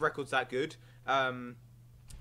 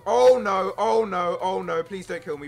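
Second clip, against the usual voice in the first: mock panic; 2 semitones higher, 8 dB louder than usual. Pleading with the opponent not to kill him, hammed-up loud panic. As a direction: ham up a frantic plea for mercy.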